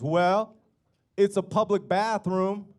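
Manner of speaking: high, loud, drawn-out reply; gang leader's retort. Retold or imitated. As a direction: act out an emphatic leader laying it out slowly.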